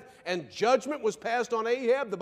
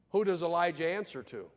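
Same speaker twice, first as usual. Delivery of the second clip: usual speaking voice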